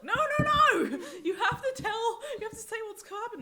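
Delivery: high, very loud protest through laughter; frantic insistence. Retold or imitated. Child begging mum to finish the story, frantic insistence. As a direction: refuse to let them stop, shrieking.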